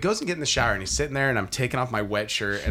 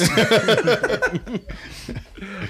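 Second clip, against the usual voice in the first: high laugh trailing off; laughter; 8 semitones higher than usual. Real, not acted. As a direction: laugh at your own story. Laughing after quote.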